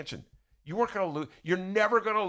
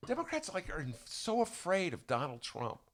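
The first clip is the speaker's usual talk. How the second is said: ordinary talk, nothing marked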